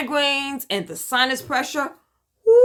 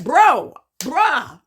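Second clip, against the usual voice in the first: exasperated; 5 semitones higher, 7 dB louder than usual. Retold or imitated. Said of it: "I was like".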